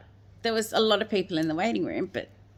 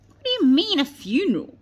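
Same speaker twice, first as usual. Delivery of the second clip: high, loud, stunned question; an incredulous outcry, as remembered; retold disbelief; 8 semitones higher, 7 dB louder than usual. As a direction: let the shock burst out, as told. Shocked reply to the doctor.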